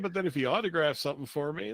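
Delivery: ordinary talk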